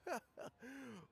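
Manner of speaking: put-on a pleased little old man's chuckle; soft chuckle. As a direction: chuckle gently as guests arrive. Elder greets visitors warmly.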